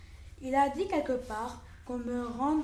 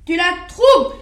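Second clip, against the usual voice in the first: loud angry shout; wolf shouting down the lamb; cruel wolf; 5 semitones higher, 15 dB louder than usual. Acted. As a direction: bark the accusation like a cruel beast. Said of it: "reprit cette bête cruelle".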